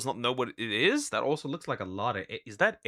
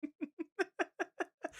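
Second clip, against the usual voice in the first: high giggle; 11 dB quieter than usual. Real, not acted. Laughing at mispronunciation.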